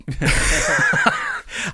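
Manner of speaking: a high helpless giggle; nervous laugh. Real, not acted. Stumped by the story, a high awkward laugh. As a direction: laugh it off, at a loss. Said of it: "I laugh because I don't know".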